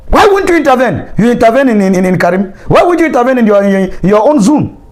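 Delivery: high, indignant demand; angry. Real, not acted. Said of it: "I'm just being angry".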